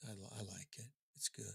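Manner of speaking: low, very soft, no energy; barely-there reply to the radio host. Retold or imitated. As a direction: mumble it like you'd rather not be interviewed. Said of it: "Serious. That's what he sounds like".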